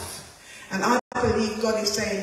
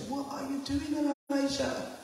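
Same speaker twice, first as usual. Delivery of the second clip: gentle question from God; 4 semitones higher, 7 dB quieter than usual. Acted. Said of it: "God turns around again and says".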